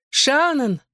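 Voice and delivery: high, alarmed woman's call; frightened call. A narrator's reading. Mother calls to her daughter down the corridor, frightened. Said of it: "послышался испуганный женский голос".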